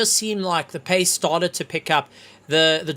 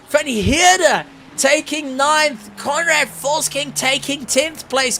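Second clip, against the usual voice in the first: high, quick rundown of places; excited results read; 9 semitones higher, 4 dB louder than usual. Real, not acted. Commentator listing finishers to viewers, excited. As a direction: rattle off the order.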